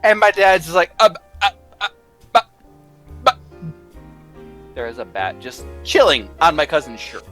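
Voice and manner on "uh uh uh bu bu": ordinary talk, nothing marked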